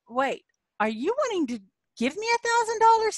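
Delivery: high disbelieving double-take; retold surprise. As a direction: replay the moment it finally clicks. Realizing the friend offers money.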